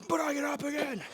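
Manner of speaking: high strained grunt-talk; straining effort. Real, not acted. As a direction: push the words out under a heavy lift. Straining through a lift.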